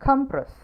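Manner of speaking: "compress" with COM high and loud, then falling; careful noun "compress", COM-press; stress on first syllable. Shown as a demonstration. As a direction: punch the opening COM of "compress". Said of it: "first സിലബിളിലാണ്".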